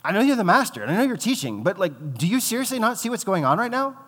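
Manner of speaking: high and loud, exasperated complaint at the master; frustrated sister scolding her guest; resentful outburst. Acted. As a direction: let the resentment boil over at Jesus. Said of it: "she's resentful at Jesus".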